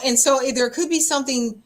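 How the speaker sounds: usual speaking voice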